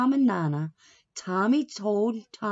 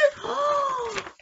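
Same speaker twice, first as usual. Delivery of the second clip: gasp. Real, not acted. Reader reacts to the teacher.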